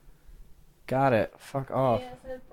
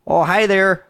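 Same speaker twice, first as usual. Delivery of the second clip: bright folksy Midwestern greeting, higher; Wisconsin accent; 6 semitones higher, 5 dB louder than usual. Pretend. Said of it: "best Wisconsin accents".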